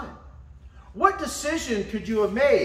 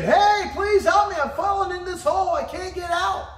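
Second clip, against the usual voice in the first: retold shout for help, higher and louder; mock yell for help; 11 semitones higher, 4 dB louder than usual. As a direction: holler up for help, hammed-up. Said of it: "He's yelling and screaming".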